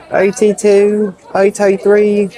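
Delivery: high, loud South African call of order numbers; South African accent. Retold or imitated. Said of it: "in a South African accent".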